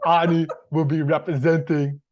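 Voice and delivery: put-on loud Austrian accent; Arnold Schwarzenegger accent. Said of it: "his accent for a bit".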